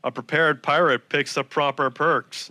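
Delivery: slow, deliberate tongue twister; measured, crisply enunciated reading; careful enunciation. Shown as a demonstration. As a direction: pop each P with care. Reads tongue twister with care, enunciating.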